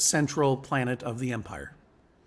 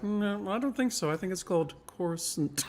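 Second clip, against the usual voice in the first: higher, hesitant-sounding correction; imitated film-maker demurring, pitched up; George Lucas; 7 semitones higher than usual. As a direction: say Coruscant as CORE-uh-sunt, politely correcting. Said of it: "George Lucas is like".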